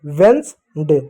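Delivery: वेडनेसडे as vens, day; two-syllable Wednesday. Shown as a demonstration. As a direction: drop the middle vowel of वेडनेसडे. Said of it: "वेडनेसडे होता है". (दो हिस्सों में "वेडनेसडे")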